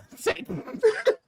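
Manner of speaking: squealing laugh, high and breaking; high burst of laughter. Real, not acted.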